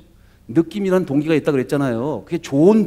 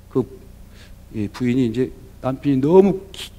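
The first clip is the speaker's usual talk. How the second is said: ordinary delivery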